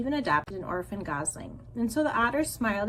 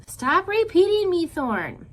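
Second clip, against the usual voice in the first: angry; 9 semitones higher, 8 dB louder, slower than usual. A narrator's reading. Sister scolds brother.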